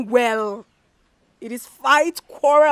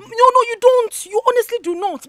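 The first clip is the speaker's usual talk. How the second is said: angry denial; 7 semitones higher, 8 dB louder than usual. Acted. Daughter quarrels with mother.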